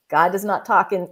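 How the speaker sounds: normal talking voice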